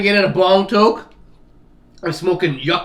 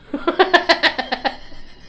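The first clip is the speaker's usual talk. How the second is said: high breathy giggle; 12 semitones higher than usual. Real, not acted. Giggling at own joke.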